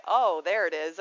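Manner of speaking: mock cold dismissal; dismissive Scorpio brush-off. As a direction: shrug it off like the Scorpio who won't say sorry. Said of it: "the Scorpio will just never apologize".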